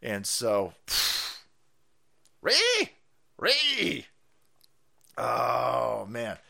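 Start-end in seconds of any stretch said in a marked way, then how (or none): 2.4-4.1 mocking high-pitched squeal; high squealing ree. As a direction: squeal it like a cartoon pig.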